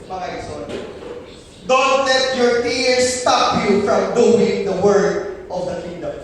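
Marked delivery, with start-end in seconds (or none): 1.7-6.1 slow exhortation. Real, not acted.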